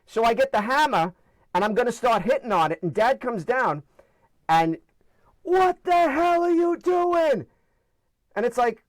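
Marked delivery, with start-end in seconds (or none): 5.4-7.5 high, slow, startled yell of a father; dad bursting in, horrified; alarmed dad. Retold or imitated. Dad catches kid hammering shell.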